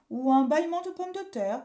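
high, loud grandmother voice; joking grandmother. Acted. Grandmother adds to the family joke, playful. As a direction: toss in a playful suggestion.

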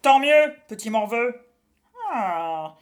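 loud taunting witch; a witch enjoying his pain; gloating witch. A narrator's reading.

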